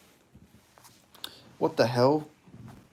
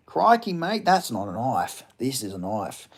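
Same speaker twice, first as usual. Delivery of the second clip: Crocodile Dundee quote; 2 semitones lower, slower than usual. Retold or imitated. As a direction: do the famous knife line as a lightly voiced Aussie bushman. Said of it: "Have you watched Hunting for the Wilder People".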